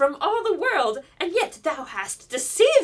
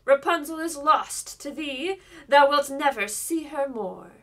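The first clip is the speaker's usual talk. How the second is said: mocking; 8 dB louder than usual. A narrator's reading. Enchantress taunts the king's son at the window, loud mocking. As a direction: taunt him, savouring every word. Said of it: "she cried mockingly".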